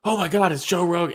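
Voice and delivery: high, over-the-top starstruck gush; mimicking the fighter's over-the-top fangirling; mock fangirl gush. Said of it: "the over the top".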